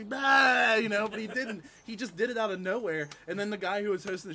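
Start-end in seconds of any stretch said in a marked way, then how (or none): 0.0-0.7 loud, high "bah" burst; imitated bleating yell. Pitching a funnier callback for the comic, loud mimicked "bah". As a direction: blurt it like the comic's lewd noise. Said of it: "remember when I said I like eating pussy".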